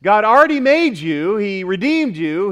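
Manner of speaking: ordinary delivery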